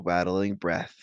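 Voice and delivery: ordinary delivery, nothing marked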